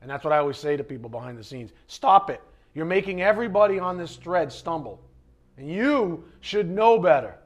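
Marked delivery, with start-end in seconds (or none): 1.9-7.4 raised voice, higher and emphatic; loud, forceful telling-off; stern scolding. Retold or imitated. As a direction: scold them to cut it out. Acted scolding of arguing believers.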